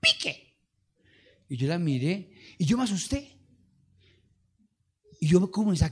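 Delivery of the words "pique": very high put-on woman's voice; mocking woman's voice. Pastor imitating the woman at the church door, very high. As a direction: mimic the woman's shrill warning. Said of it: "Así me dijo".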